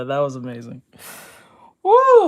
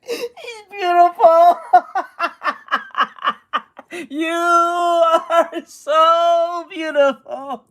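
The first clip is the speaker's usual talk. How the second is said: laughing; 3 semitones higher, much slower than usual. Real, not acted. Laughing hard at Piglet picture.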